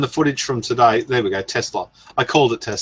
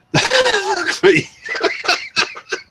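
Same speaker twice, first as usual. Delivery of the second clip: real delighted laugh, high and far; high burst of laughter. Real, not acted. Laughing at the chat's joke, a high real laugh. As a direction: cracks up at the chat.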